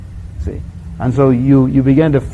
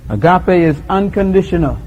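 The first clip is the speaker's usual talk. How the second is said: measured preaching cadence; solemn litany; 7 dB quieter than usual. Acted. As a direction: pronounce each truth deliberately.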